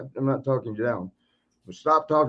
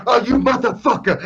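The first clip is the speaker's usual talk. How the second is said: much higher, louder furious shout; outraged yell; 10 semitones higher, 7 dB louder than usual. Retold or imitated. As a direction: explode at the prankster. Sheik realizes he was tricked.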